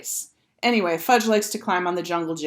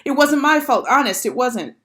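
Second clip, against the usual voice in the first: high, raised-voice protest; crying out defensively; 6 semitones higher, 5 dB louder than usual. A narrator's reading. Girl denying blame for the fall, crying out defensively. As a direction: plead innocence with pitch and volume up. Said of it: "Sheila cried".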